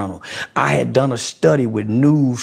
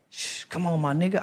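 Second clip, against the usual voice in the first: much softer, hushing; whispered aside; hushed; 9 dB quieter than usual. Real, not acted. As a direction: drop to a hush. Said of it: "hushing himself".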